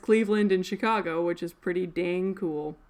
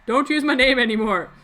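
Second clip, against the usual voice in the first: higher, louder mock demand; demanding quote; 5 semitones higher, 8 dB louder than usual. Steve Nash demanding the club drop his name, mock indignant. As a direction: play the offended party insisting. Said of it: "they're like".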